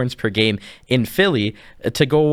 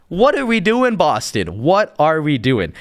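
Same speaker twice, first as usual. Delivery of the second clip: exasperated disbelief; 2 semitones higher than usual. Real, not acted. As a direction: demand answers from a team you can't believe. Mock-pleading outrage at Boston's trade.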